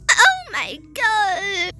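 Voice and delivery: high, drawn-out tearful cry; slow mock-horrified whine; play-acted dread. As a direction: wail it in pretend fright. Playing a tornado panic.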